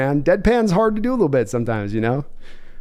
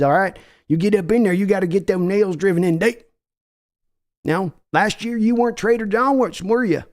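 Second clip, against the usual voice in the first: folksy Texan owner voice, pushy; brash Texas accent of a team owner; Jerry Jones impression. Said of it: "That was me, Jerry Jones".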